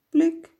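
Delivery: high, short, loud "plic"; tear-drop plink. A narrator's reading. Storyteller sounding giraffes' falling tears, high plinking drops. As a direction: pipe each drop as a sudden tiny plink.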